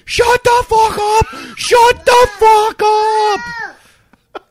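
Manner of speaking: full-volume, high, drawn-out angry scream; fuming shout. Retold or imitated. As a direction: scream each word slowly at the wall. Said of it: "I'm fucking fuming".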